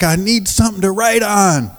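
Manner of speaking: put-on very high, urgent drunk voice; drunk man pleading. Drunk man on the street asking the pastor for paper, high and urgent. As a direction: beg for paper like a desperate drunk. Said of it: "he's like".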